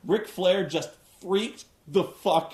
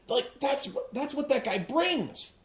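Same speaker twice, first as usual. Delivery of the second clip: ordinary delivery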